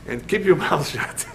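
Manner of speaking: chuckling while delivering the punchline; laughing through it. Retold or imitated. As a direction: break into a laugh on mouth shut. Rabbi quotes giving money, laughing.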